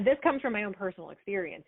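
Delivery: normal talking voice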